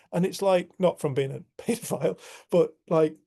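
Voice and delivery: ordinary delivery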